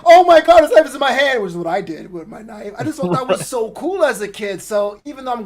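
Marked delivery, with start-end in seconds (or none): 0.0-1.4 panicked. Retold or imitated. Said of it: "not like".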